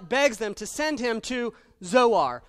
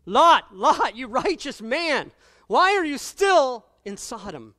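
loud, high pleading call across the ages; preacher shouting a rebuke to Lot; 5 semitones higher, 8 dB louder, slower than usual. Acted. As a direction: cry out to Lot like you're begging him to leave.

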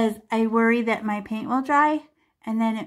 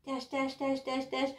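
quiet chanting in time with strokes; soft rhythmic murmur; 10 dB quieter than usual. Shown as a demonstration. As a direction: murmur each dash softly. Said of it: "demonstrating dabbing strokes".

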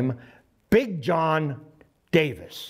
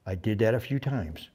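ordinary delivery

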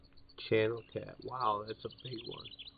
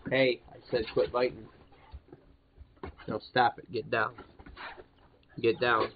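loud, firm commands to a biting dog; stern and loud, make the dog stop; firm scolding; 6 dB louder than usual. Real, not acted.

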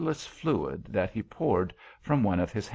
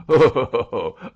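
loud, higher, booming "ho ho ho"; mocking laugh; 5 semitones higher, 11 dB louder than usual. A narrator's reading. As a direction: laugh scornfully at an empty threat. Angel laughs mockingly at the threat.

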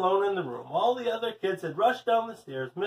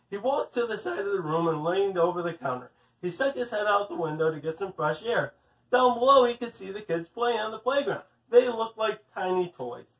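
plain reading voice

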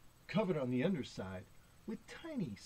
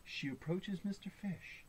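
plain reading voice

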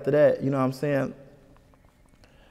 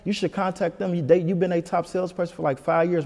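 higher-pitched take on a mother advising; imitating mom; 6 semitones higher than usual. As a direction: lightly voice mom talking it through, pitched up. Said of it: "She was like".

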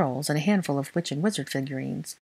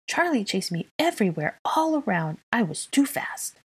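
put-on higher, swooping little-kid voice; enthusiastic little child; 6 semitones higher than usual. A narrator's reading. Child telling how Charlie chased him, enthusiastic. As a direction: tot bragging with glee. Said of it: "at the enthusiastic Tyke".

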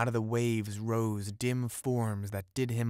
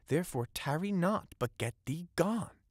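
higher-pitched, mildly urgent dismissal; dismissive; 8 semitones higher than usual. A narrator's reading. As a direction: send them away with a lifted voice. Fisherman sends his soul away.

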